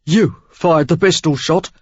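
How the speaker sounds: a hint of a wounded Cockney man; rough Cockney. A narrator's reading.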